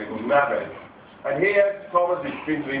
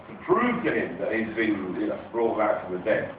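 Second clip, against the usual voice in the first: usual speaking voice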